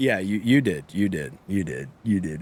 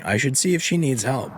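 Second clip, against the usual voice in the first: normal talking voice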